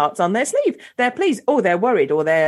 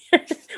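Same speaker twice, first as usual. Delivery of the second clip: light high giggle; 8 semitones higher than usual. Real, not acted. Host laughs at guest's talk.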